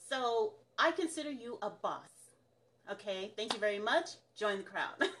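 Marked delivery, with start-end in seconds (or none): none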